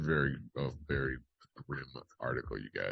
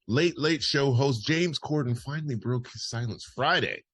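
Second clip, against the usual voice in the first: punchy, higher-pitched headline delivery; news read; 8 semitones higher, 5 dB louder than usual. Acted. Host reads listeners a parody celebrity story, news read. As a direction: announce it like a breaking entertainment story.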